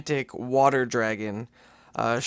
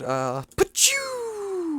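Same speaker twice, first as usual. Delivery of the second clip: high, drawn-out zoom noise "pachoo"; whoosh sound effect; 4 dB quieter, much slower than usual. Shown as a demonstration. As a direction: shoot off with a squeaky whoosh. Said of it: "That kind of sound effect you make when you wanna talk about going fast".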